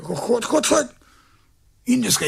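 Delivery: ordinary talk, nothing marked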